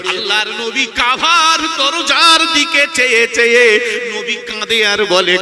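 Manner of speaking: very loud, half-sung preacher's delivery; full-force preaching. Acted. Preacher retells the Prophet and Abu Bakr to the congregation, loud chanted preaching.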